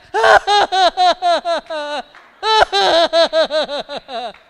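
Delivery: very loud, high forced ha-ha-ha; booming mechanical laughter in bursts; fake laugh. Shown as a demonstration. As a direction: put on a huge fake laugh. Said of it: "put on a fake laugh".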